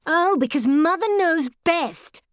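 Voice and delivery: high, mocking teenage sneer; sarcastic. A narrator's reading. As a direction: throw it back sarcastically. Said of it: "responded Melanie sarcastically".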